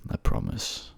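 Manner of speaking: soft low unvoiced whisper of "promise". Lover whispers a vow to himself while going down, whispered. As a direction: breathe the promise almost to yourself. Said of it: "I'm coming to get you, my love".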